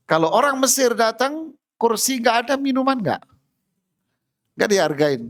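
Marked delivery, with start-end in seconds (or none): none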